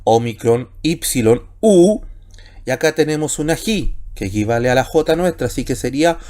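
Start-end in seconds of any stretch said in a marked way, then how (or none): 1.6-2.0 "U" as one long oo, higher and louder; single vowel sound. Shown as a demonstration. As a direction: read "U" as a single pure oo. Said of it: "¿cómo leemos?".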